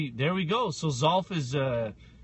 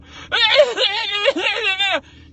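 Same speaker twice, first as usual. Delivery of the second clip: high loud burst of laughter; big laugh; 14 semitones higher, 7 dB louder than usual. Real, not acted.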